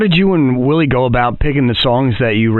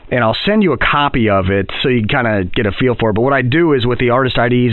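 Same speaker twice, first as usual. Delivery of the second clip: usual speaking voice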